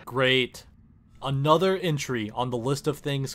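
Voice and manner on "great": ordinary talk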